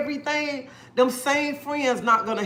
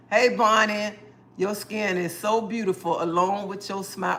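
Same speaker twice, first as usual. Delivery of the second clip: ordinary talk, nothing marked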